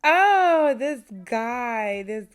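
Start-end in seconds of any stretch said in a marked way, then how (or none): 0.0-0.7 exasperated shout, much higher and louder; sudden furious oh; angry exclamation. Real, not acted. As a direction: burst out in real frustration. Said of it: "I am so mad".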